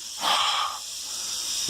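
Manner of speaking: long unvoiced huff of breath. Shown as a demonstration. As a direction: blow out to smell your breath. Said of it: "My breath smells fresh".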